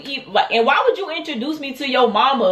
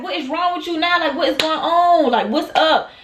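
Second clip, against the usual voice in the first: higher-pitched, baffled imitation; shocked questioning; 5 semitones higher than usual. Storyteller voicing Demon after being smacked, shocked. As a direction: imitate someone taken aback, voice raised in pitch. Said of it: "So, he's shocked. He like".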